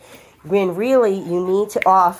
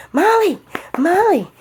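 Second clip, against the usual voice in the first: much higher sing-song calls of Molly; calling the dog; 12 semitones higher than usual. Real, not acted. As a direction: call Molly brightly to get her attention.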